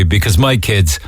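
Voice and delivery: normal talking voice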